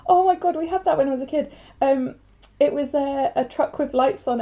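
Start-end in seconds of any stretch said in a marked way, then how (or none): 0.1-1.1 high, loud emotional gushing; overwhelmed excitement. Real, not acted. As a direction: gush like you might cry. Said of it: "I'm gonna cry".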